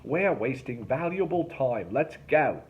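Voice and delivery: loud, impatient huff. A narrator's reading. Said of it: "Derek huffed".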